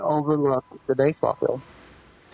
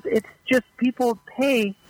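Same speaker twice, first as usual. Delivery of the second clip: higher, casual retold explanation; clubhouse manager explaining; 7 semitones higher than usual. Manager explaining the paying fans to the rookie, higher voice. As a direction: explain it offhand, pitched up. Retold locker room exchange.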